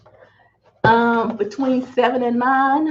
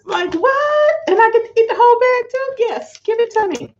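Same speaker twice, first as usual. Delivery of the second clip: high, excited squeal of delight; can't believe her luck, squealing; thrilled disbelief; 12 semitones higher than usual. Real, not acted. Vlogger thrilled to get whole chip bags, squealing with delight.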